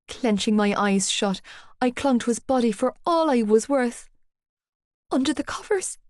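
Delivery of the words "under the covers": strangled. A narrator's reading. Girl telling Johnny where the thing is, strangled with fear. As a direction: squeeze it out through fear. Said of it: "I strangled out".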